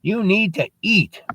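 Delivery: put-on high, insistent child's voice; little girl. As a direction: tell a grown-up off in a high little voice. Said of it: "She goes".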